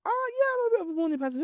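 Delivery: fast, chirpy mock cheer; mock perky voice. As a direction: sound fake-cheerful about mornings. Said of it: "I wouldn't say".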